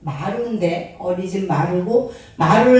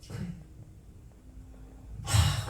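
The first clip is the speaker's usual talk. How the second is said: normal talking voice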